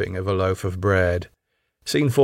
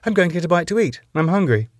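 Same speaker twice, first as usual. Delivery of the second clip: high, breezy reply; bright; 7 semitones higher, faster than usual. A narrator's reading. Wife heads off for a bite, brightly. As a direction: toss it off brightly, pitched up. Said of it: "Doreen, brightly".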